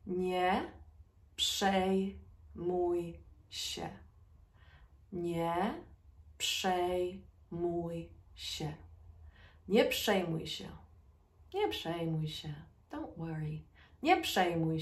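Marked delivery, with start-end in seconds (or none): 0.1-8.7 broken into slow syllables; word by word teaching pace. Shown as a demonstration. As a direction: say each syllable apart, slowly. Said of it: "Say it with me".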